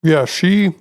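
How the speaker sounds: talking through a mouthful of marshmallow; words muffled round a bite of food; mouth full. Real, not acted. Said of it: "I have marshmallow in my mouth".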